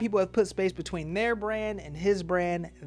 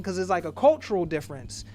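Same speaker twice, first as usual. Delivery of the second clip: ordinary talk, nothing marked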